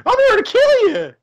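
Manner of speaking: very high laughing clown voice; squeaky clown voice, laughing; rodeo clown. Pretend. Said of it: "I sound like the rodeo clown".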